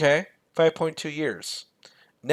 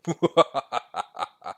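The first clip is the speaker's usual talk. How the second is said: lightly voiced evil villain laugh; a short gloating bad-guy laugh; Shao Kahn villain laugh; 3 dB louder than usual. Host gloating over his lines of credit, mock villain laugh. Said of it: "sound like the bad guy from Mortal Kombat, Shao Kahn".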